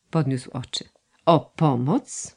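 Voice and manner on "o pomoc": slow, pressing "O pomoc?" with weight on "pomoc"; repeated with emphasis. A narrator's reading. Son repeating his mother's plea, slow and emphatic on "pomoc". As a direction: lean slowly on "pomoc", making it a pointed question. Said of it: "powtórzył z naciskiem".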